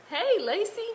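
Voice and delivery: high, loud hello; calling out a greeting. Real, not acted. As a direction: break off to hail a friend brightly. Greeting an arriving viewer.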